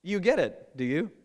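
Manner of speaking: gently mock their confidence; amused irony. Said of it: "And his response is".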